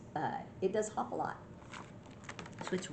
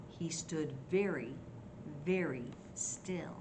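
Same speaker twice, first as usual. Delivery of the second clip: softer, spaced-out storytelling; hushed suspense; 2 semitones lower, 6 dB quieter than usual. A narrator's reading. Grandma tells children Mouse froze, hushed suspense. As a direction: drop softer and pause before the last words.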